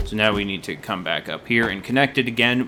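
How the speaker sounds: ordinary delivery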